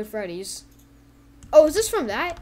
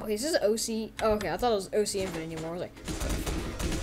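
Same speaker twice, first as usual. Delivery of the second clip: ordinary talk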